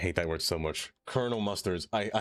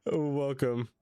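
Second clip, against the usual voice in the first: ordinary talk, nothing marked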